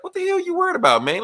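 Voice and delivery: squeaky high-pitched exasperated voice. Retold or imitated. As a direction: pitch way up, incredulous. Character scolds nervous friend.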